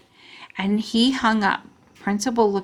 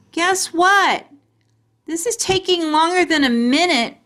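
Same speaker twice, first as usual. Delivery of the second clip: impatient call; 9 semitones higher than usual. A narrator's reading. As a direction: shout out from inside the bag. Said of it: "I said from inside there".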